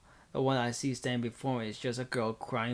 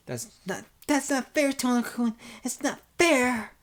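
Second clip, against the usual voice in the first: put-on high, loud childish wail; spoiled child shouting; 11 semitones higher, 5 dB louder than usual. Senpai protesting to Tona-kun, shouting like a spoiled child. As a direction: whine it out loudly like a spoiled child. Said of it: "shouts out like a spoiled child".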